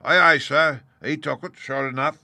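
loud gruff sailor reply; gruff pirate. A narrator's reading. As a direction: bark it back like an old salt. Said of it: "returned Morgan".

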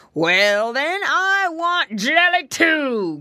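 loud, drawn-out growl, pitch climbing high; growling bear. A narrator's reading. Bear wanting some jelly too, growling. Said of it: "growled the bear".

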